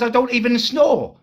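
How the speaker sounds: high-pitched mock female voice; squeaky impression of an affronted woman; indignant girlfriend. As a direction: imitate her offended denial, high and loud. Mimics girlfriend freaking out.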